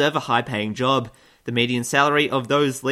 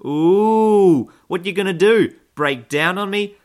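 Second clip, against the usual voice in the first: mock taunt; 5 semitones higher, 6 dB louder, slower than usual. Taunting the Iranian submarine.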